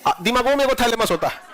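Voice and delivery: normal talking voice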